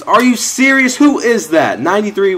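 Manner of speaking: high, loud shocked yelling at the screen; can't believe what just happened; real incredulous shout at the game; outraged disbelief. Real, not acted. Gamer outraged at missed tackle.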